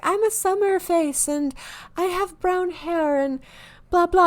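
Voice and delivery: high-pitched mock customer voice; imagined quiz customer. Imagined customer answering a quiz, high put-on voice. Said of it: "someone's like".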